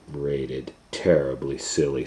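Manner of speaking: usual speaking voice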